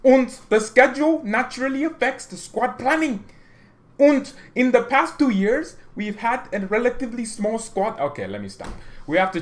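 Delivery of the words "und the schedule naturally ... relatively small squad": Rummenigge read in a hammed-up German accent, high and loud; mock German accent. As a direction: put on a thick German accent for the quote. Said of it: "let me read it in my German accent".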